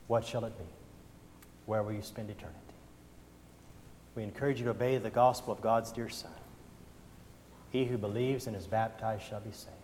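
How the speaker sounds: quiet, gentle altar call; hushed closing appeal; soft invitation. Acted. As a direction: softly invite them to come forward.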